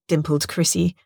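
ordinary delivery

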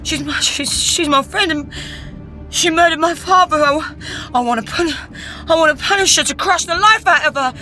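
high, loud rage breaking into a shout; angry shout. A narrator's reading. Matt raging at Cecile, furious shout. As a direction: build from stammer to a furious shout. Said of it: "Matt shouted angrily".

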